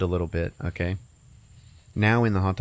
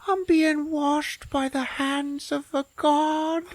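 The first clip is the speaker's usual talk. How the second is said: high, slow, reverent squeak; tiny cartoon potato, awestruck; awed potato voice; slower than usual. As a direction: squeak it like a chosen little potato in awe. Potato in the sink.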